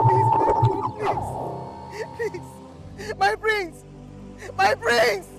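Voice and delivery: frantic sobbing pleas; begging him to wake, crying; grief-crazed. Acted. Mourning woman begs prince wake.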